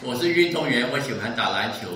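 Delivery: ordinary delivery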